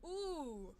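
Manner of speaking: ordinary talk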